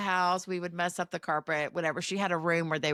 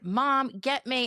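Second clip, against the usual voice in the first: put-on slow, higher, demanding kid's voice; 6 semitones higher, much slower than usual. Child demanding from mom, mock demand. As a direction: mimic a bossy child. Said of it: "they would demand".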